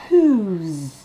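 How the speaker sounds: "whose" ending in a buzzing z; final z sound. Shown as a demonstration. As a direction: end "whose" on a clear z. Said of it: "Comme un Z à la fin". ("whose" avec un z final)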